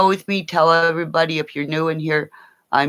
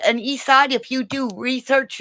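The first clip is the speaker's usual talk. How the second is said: ordinary talk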